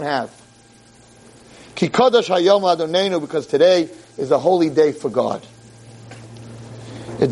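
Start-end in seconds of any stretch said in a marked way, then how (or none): none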